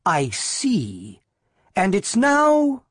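drawn-out mock understanding, pitch leaping up at the end; slow, mocking build. A narrator's reading. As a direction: stretch it with lofty sarcasm. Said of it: "Missus McGuire said mockingly".

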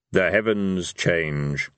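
low, very slow Death voice; solemn Death. A narrator's reading. Death answering Vina about the stars, slow and solemn. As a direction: intone it slowly, low and grave.

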